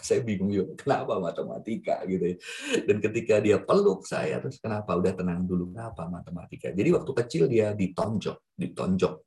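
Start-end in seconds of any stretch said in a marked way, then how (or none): 4.6-6.7 hushed, calming voice; quiet reassurance fading almost to nothing; soothing. Retold or imitated. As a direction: settle him down gently. Calming the sobbing man.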